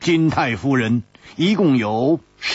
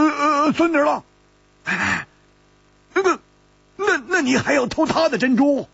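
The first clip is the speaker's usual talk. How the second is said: high, exasperated scolding with a whine; high whining reproach; scolding, high-pitched friend; 12 semitones higher than usual. Acted. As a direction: tease him in a pitched-up, half-crying scold. A character scolds Zhang San.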